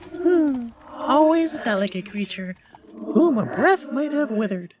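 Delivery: very high, otherworldly spirit; ghost voice. A narrator's reading. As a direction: float it like an eerie spirit. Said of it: "said the ghost".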